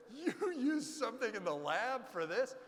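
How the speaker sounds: high, loud, laughing question; incredulous laughing question; laughing disbelief. Retold or imitated. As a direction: laugh through the amazement. Amazed question, laughing as he tells.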